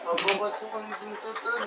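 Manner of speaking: put-on slurred, quiet drunken mumble. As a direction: slur the words after a night drinking. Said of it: "Lasing ka na naman".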